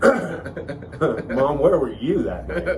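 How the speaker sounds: laughing as he questions her; mock accusation. Son jokingly suspects mom of the plot, laughing mock accusation. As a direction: pretend interrogation broken by laughter.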